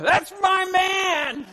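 slow, high proud shout; wife hollering about her husband in church, proud shout. Shown as a demonstration. Drawn-out loud boast. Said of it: "Ladies, you ought to be hauling".

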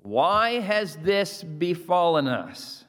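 each word drawn out for the congregation to repeat; slow leader's chant for the room to echo; slow, emphatic call and response. Shown as a demonstration. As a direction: lead the room through it slowly. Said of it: "Say it with me".

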